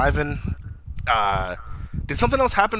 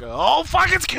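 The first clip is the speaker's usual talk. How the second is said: much higher, excited squeal; excited yelp; 14 semitones higher, slower than usual. Retold or imitated. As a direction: yelp it like a thrilled fan. Excited reaction to Coop.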